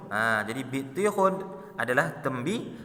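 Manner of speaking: ordinary delivery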